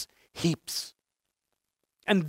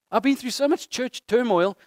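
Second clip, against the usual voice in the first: normal talking voice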